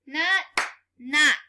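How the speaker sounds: loud, punched each not; chanted word, loud; clapped and called out. Shown as a demonstration. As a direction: clap out every not. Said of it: "Clap it".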